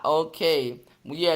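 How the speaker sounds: usual speaking voice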